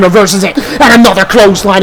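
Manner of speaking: ordinary talk, nothing marked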